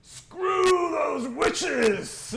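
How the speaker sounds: long, drawn-out, high bellow; full-throated tavern battle cry; rowdy orc shout. Acted. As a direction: roar it as a drinking toast, stretching the words. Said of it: "there are cheers and they chug back".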